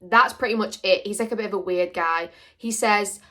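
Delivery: ordinary talk, nothing marked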